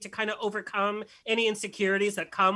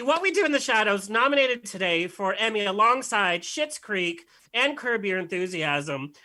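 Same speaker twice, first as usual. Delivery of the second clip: ordinary talk, nothing marked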